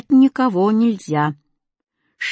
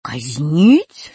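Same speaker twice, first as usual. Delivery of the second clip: low, loud, long rising question; questioning cat; 4 semitones lower, 5 dB louder than usual. A narrator's reading. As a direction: ask it slowly in disbelief, swooping up. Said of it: "спросил Кузя". (удивлённый вопрос кота)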